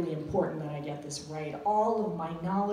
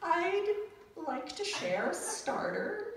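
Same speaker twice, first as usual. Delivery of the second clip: stiff phrasebook tourist; 10 semitones higher, slower than usual. Retold or imitated. Phrasebook Italian to police.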